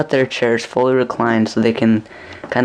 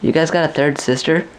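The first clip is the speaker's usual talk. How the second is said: higher, eager mock ask; shy guy's cheeky line; 5 semitones higher than usual. Smoking Ryan asking the dreamer about a third sister, eager. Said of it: "and he goes".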